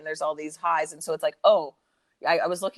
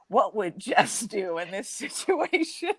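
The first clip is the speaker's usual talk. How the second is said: mock quote through laughter; 4 semitones higher, slower than usual. Mocking ex's excuse, laughing.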